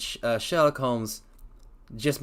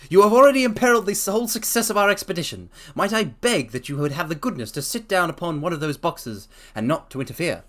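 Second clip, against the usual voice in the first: louder, faster stern rebuke; stern Holmes; 7 semitones higher, 5 dB louder than usual. Acted. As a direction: press the scolding home. Holmes continues scolding.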